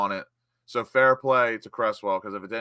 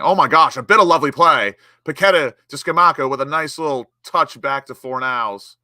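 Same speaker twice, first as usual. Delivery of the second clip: a loud burst of real excitement at the play; excited commentary; 3 semitones higher, 8 dB louder than usual. Real, not acted. Fan watching his team build an attack, excited commentary. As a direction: call the move like a thrilled fan. Live goal-chance commentary.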